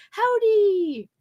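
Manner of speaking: ordinary talk, nothing marked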